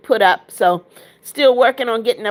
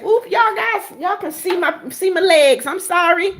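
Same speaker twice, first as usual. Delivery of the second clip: higher, flustered oops and apology; suddenly much higher, startled and apologetic; caught off guard; 8 semitones higher than usual. Real, not acted. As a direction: laugh it off, embarrassed at the slip. Legs accidentally shown on camera.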